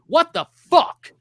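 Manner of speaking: loud, high, disbelieving; exasperated outburst. Real, not acted. Host ranting about Halloween Ends, loud outraged disbelief.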